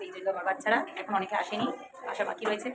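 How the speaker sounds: ordinary talk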